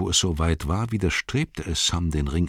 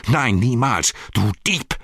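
higher, louder, hostile accusation; furious shout; 6 semitones higher, 4 dB louder than usual. Acted. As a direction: spit it at him as a thief. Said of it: "schrie Frodo".

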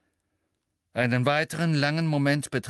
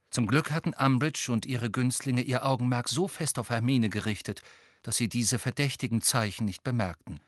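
usual speaking voice

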